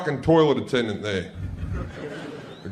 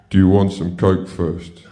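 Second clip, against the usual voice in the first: other man; 8 semitones lower, 3 dB louder than usual. Retold or imitated. Other man's voice, lower.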